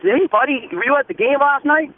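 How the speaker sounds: mock excited airport man; friendly greeting. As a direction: greet the boy brightly. Gentleman greets boy, retold.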